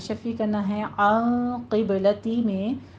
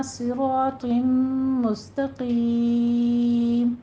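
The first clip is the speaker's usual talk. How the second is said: slow melodic tajweed recitation; chanted Quranic recitation; 3 semitones higher, much slower than usual. Shown as a demonstration. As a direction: recite each word slowly and carefully. Recites Quran verse slowly.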